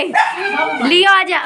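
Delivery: slow, stretched call to the dog; drawn-out call. Real, not acted. Calling the dog Leo down from the roof, slow and drawn out. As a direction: coax the dog over slowly.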